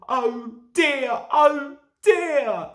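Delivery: tearful lament; rising to tearful wail. A narrator's reading. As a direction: wail it like an engine about to cry. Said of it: "James began to cry".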